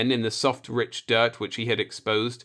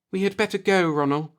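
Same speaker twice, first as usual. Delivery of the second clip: higher, tense and uneasy; a touch of fright in a higher voice; frightened; 8 semitones higher than usual. A narrator's reading. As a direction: plead to leave, scared. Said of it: "Marla was frightened now".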